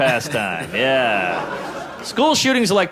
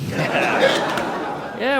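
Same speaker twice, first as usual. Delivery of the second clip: usual speaking voice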